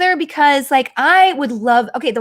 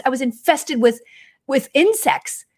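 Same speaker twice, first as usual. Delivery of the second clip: ordinary talk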